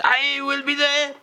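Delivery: very high, eager mock-French cry. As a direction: pipe up excitedly in a French accent.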